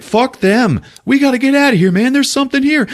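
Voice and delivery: high, loud mock panic; panicked. Podcaster voicing Treat panicking, high mock panic. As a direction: squeal that you need to get out. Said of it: "Treat's like".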